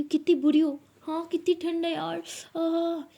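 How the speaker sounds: high, loud shivering scold; loud playful protest. Acted. Lakshmi to Lili in the cold pool, loud playful protest. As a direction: yell at her, half laughing in the cold.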